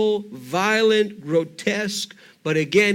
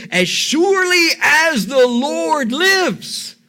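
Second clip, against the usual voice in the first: loud furious oath, slow and heavy; burning anger; 7 semitones higher, 6 dB louder, slower than usual. Acted. David swears an oath to Nathan in a furious shout. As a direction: swear it in a blazing rage. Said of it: "David burned with anger".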